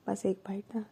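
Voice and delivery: soft pleading whisper; lovingly. A narrator's reading. Wife coaxing her wife for one bite, soft loving whisper. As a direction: coax her sweetly, almost breathless. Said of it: "बहुत प्यार से बोलती है".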